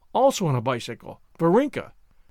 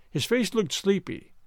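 ordinary delivery, nothing marked

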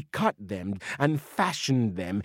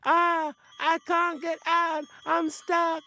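high, thin cries of being stuck; high-pitched panicky forest spirit; trapped spirit voice; 13 semitones higher than usual. A narrator's reading. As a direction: cry out high, struggling to get free. Spirit voice, caught in the honey.

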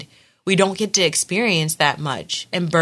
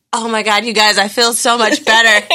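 higher, louder gush of relief with laughter; elated relief; 6 semitones higher, 5 dB louder than usual. Retold or imitated. Mother retelling her water breaking, elated relief.

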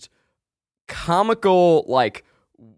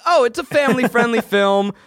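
mock innocence; 9 semitones higher, 3 dB louder, faster than usual. Coaxing grandma into renting a movie.

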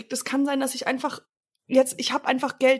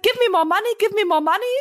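raised, insistent mock hold-up line; retold English robbery demand; 7 semitones higher, 4 dB louder than usual. Robber showing partner the English demand, raised retold voice. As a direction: replay the demand in German-accented English, pitched up. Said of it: "genau, ich hab gesagt".

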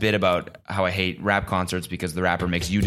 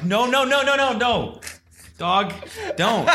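pleading protest; slower than usual. Retold or imitated. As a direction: beg him to drop it, laughing. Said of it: "I'm like".

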